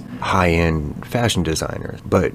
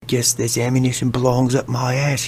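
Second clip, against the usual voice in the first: hammed-up British accent, pitched higher; mock British chap; 8 semitones higher than usual. Host voicing the British bomber mocking him, hammed-up British accent. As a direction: play the smug English bloke talking to himself.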